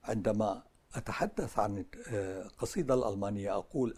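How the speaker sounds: ordinary talk, nothing marked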